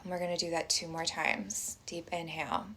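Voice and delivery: soft, unhurried meditation voice with long pauses; guided meditation. Acted. Teacher inviting everyone to close their eyes and breathe, guided meditation.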